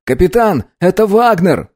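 high-pitched cadet reporting in; loudspeaker report. A narrator's reading. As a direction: report urgently over the intercom. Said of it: "доложил по громкой связи".

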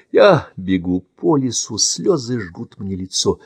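ordinary delivery

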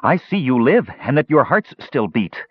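put-on high-pitched, gleeful boy; happy cry. A narrator's reading. Demon boy delighted at living travelers, happy cry. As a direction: exclaim with eerie childish delight. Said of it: "he cried happily".

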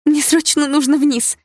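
very high, drawn-out urgent cry; urgent excitement. Acted. Daughter to mother, must run downstairs, urgent and excited. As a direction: insist you must go, bursting with impatience.

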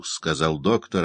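ordinary delivery

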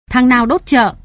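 a voice yelling behind him; angry shout. A narrator's reading. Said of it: "tiếng người quát tháo". (quát tháo giận dữ)

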